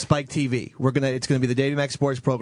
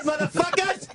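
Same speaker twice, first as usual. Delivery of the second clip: angry shout; 13 semitones higher than usual. Acted. Angry ranting impression clip.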